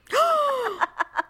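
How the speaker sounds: sharp high gasp, half laughing; mock choking gasp. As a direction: gasp like you just inhaled cinnamon. Choking on cinnamon, retold.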